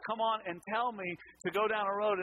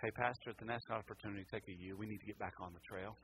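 low, soft, easy imitation of the satnav; hushed, matter-of-fact friendly guidance; what she really meant; 9 semitones lower, 10 dB quieter, faster than usual. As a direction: gently nudge the driver back, no blame. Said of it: "what she was really saying".

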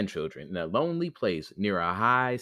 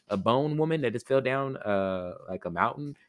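ordinary delivery, nothing marked

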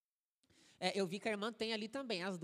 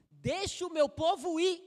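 louder and higher, slow and booming; pastor's loud punchline; 8 semitones higher, 9 dB louder than usual. Retold or imitated. Pastor delivering the punchline to the congregation, slow loud boom. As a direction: boom it out like Moses's demand. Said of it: "olha pra ele e fala assim".

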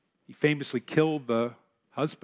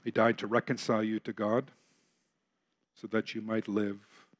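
usual speaking voice

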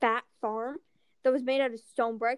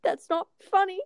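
offended; 7 semitones higher, 3 dB louder than usual. Retold or imitated. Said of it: "I was like".

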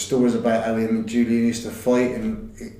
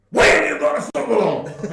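high, put-on voice, half laughing; mock indignant teammate; 12 semitones higher, 4 dB louder than usual. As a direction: squeak it like the outraged mate.

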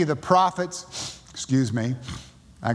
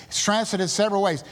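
ordinary talk, nothing marked

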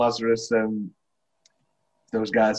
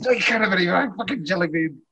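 mock stingy refusal; 7 semitones higher, faster than usual. Mocking the jelly bean hoarder.

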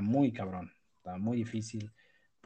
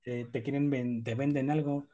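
ordinary delivery

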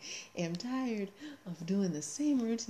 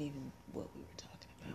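unvoiced, softer aside; whisper; 5 semitones lower, 12 dB quieter, much faster than usual. Real, not acted. Aside about the real topic.

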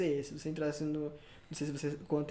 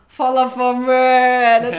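loud, higher, slow greeting call; catchphrase call; 5 semitones higher, 14 dB louder, much slower than usual. Retold or imitated. Greeting the crew at the barbecue with a loud call. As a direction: call out to everyone arriving. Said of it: "Mas essa vozinha".

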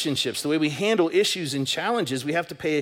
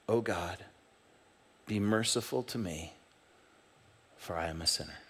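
humble contrite prayer; 5 semitones lower, 8 dB quieter than usual. Shown as a demonstration. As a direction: pray it quietly as a broken sinner. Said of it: "His tone couldn't be more strikingly different".

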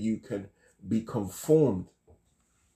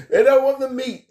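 laughing; 13 semitones higher, 5 dB louder than usual. Real, not acted. Punchline, laughing loud.

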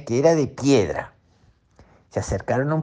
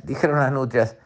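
plain reading voice

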